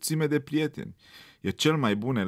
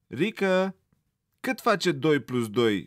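stern teacher; 6 semitones higher, 4 dB louder than usual. A narrator's reading. Teacher questions pupil sternly.

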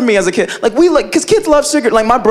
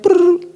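mock pouring noise; 9 semitones higher than usual. Imitating cereal pouring.

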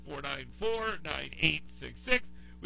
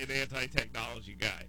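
ordinary talk

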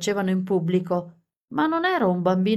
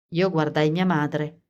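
ordinary delivery, nothing marked

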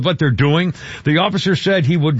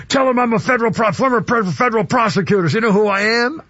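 mildly imitated indignant mother, pitched higher; mother pulling rank; 5 semitones higher than usual. Host quoting mother to police, mock self-important. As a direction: lightly mimic someone throwing their title around.

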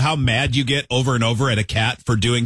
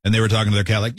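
ordinary talk

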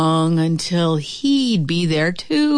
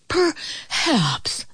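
high, lightly breathless wolf; panting; 7 semitones higher than usual. A narrator's reading. Wolf struggles in the chimney, mild panting. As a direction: pant it out in mock effort. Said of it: "the wolf panted".

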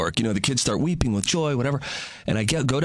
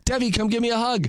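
higher, warm calling; calling to child; 8 semitones higher than usual. Retold or imitated. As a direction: call sweetly to the child. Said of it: "I said".

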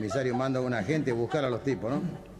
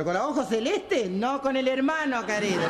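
much higher gossiping camp voice; camp caricature; 12 semitones higher than usual. Acted. One camp friend tells the other his news, much higher voice.

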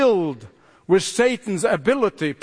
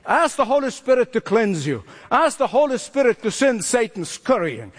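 ordinary talk

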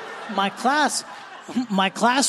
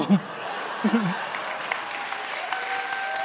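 breathy laugh; 4 semitones higher, 4 dB quieter than usual. Real, not acted. Laughs after punchline.

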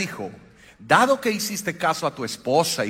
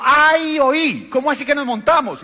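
high, loud amused exclamation; laughing disbelief; 10 semitones higher, 7 dB louder than usual. Retold or imitated. Local man laughing at the pastor, loud and high. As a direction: cry out in laughing disbelief at the free riders. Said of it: "empezó a reírse y dijo".

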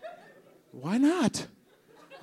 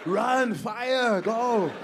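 put-on Arnold Schwarzenegger accent; 6 semitones higher, much slower than usual. Crowd hears Arnold shout about forest fires in Austrian accent. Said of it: "hear him say".

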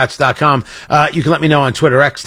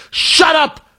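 mock tough guy; 6 semitones higher, slower than usual. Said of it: "He says".

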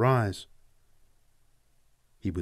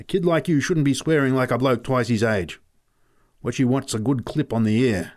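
louder, higher telling-off; scolding; 4 semitones higher, 4 dB louder than usual. Acted. Pat scolding the boy, loud telling-off. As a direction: lecture him hotly.

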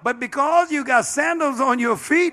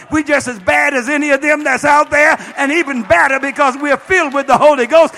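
defiant shout; 3 semitones higher, 7 dB louder than usual. Retold or imitated. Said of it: "I said".

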